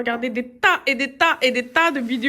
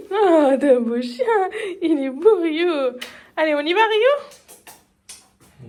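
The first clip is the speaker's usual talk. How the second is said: slow, higher, sweet dog talk; 5 semitones higher, slower than usual. Real, not acted. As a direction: coo at the dog slowly.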